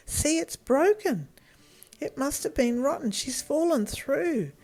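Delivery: put-on worried boy. Boy realises girl fell.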